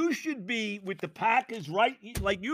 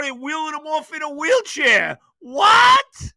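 wailing, shrieking what, much higher and louder; anguished shout; 8 semitones higher, 9 dB louder than usual. Real, not acted. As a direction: cry out in disbelief at the injury. Anguished reaction to injury.

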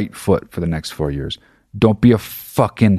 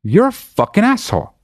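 calling him out; 8 semitones higher than usual. Retold or imitated. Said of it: "I'd be like".

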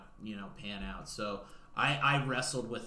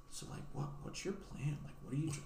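hushed question; 12 dB quieter, faster than usual. Retold or imitated. Maddie voiced in retold story.

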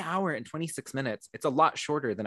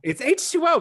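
excited; 5 dB louder than usual. Real, not acted. Exclaiming the title.

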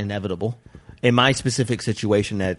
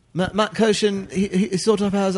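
halting, high-pitched attempt at a British accent; Matt Kirshen's British accent; 9 semitones higher than usual. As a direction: try on a London accent, faltering. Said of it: "Matt Kirshen has got that British".

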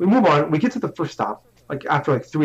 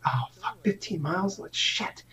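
hushed, unvoiced curse under breath; whispered dismay; 4 semitones higher, 10 dB quieter than usual. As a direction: whisper it like a shocked aside. Reacting to fifteen-mile trip.

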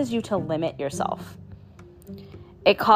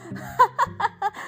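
high burst of giggles; real laugh; 13 semitones higher, 4 dB quieter than usual. Real, not acted. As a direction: laugh at your own past self. Laughs at her old episodes.

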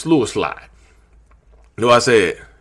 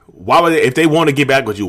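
usual speaking voice

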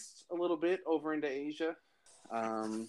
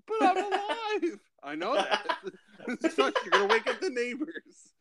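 much higher, laughing mock voice; Step Brothers quote; 13 semitones higher than usual. A host acting out a comedy scene for friends, in a high laughing mock voice. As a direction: squeak it out, giggling through the lines. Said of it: "that scene in Step Brothers".

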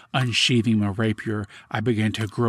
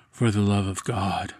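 cold echo. A narrator's reading. Captor answers his victim's last plea, coldly calm. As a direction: repeat it evenly, without any urgency.

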